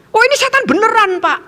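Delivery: high, emphatic exclamation; alarmed teacher. Retold or imitated. Teacher insisting to pastor about demons, alarmed. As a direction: voice leaps up in mock alarm.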